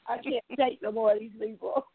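usual speaking voice

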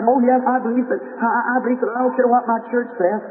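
put-on high, eager young girl's voice. Sick girl tells preacher she believes, eager and high. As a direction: pitch it up like a hopeful sick girl.

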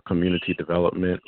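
ordinary talk, nothing marked

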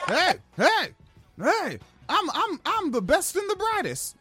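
a hint of a cocky cop; mock cop voice. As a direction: brag like a dim cop, half joking. Mocking a cop bragging.

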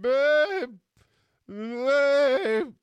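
loud, high drawn-out call; calling for his wife. Retold or imitated. Husband calling wife in the shower, loud drawn-out yell. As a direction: holler it high and long to the next room. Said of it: "And I'm calling for my wife".

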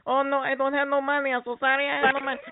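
Latka voice. Retold or imitated. Andy Kaufman pleading with muggers in a high put-on Latka voice. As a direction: play the timid Latka begging the muggers off. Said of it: "started doing, like, latke".

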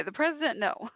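high [name] impression with French Canadian accent. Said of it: "she'd exclaim in her French Canadian accent".

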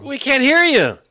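calling out. Real, not acted. Guest's mic is off.

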